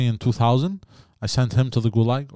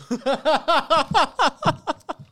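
high laugh. Real, not acted. Laughs after joke.

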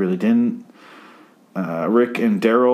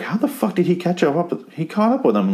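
high, disbelieving outburst; incredulous; 10 semitones higher than usual. Retold or imitated. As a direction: voice jumps up in mock disbelief. His own reaction to the scene.

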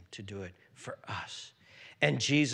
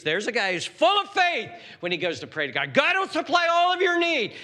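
raised, louder pulpit preaching; full force preaching; 12 semitones higher, 10 dB louder than usual. Acted. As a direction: proclaim it to the whole hall.

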